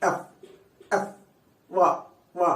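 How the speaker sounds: ఎఫ్ and వ pressed out, one at a time; pressed articulation. Shown as a demonstration. Teacher points to native speaker's pressed sounds, pressed articulation. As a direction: press lip to teeth for each ఎఫ్ and వ. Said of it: "ఆయన మాటలు కొంచెం ఒత్తు పలుకులు".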